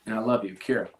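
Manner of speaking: reading his daughter's note, voice catching; long pauses, fighting back tears, rising toward the end; choked up reading. Real, not acted. Father reads daughter's farewell note aloud, choked up. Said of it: "I'll probably get choked up reading this".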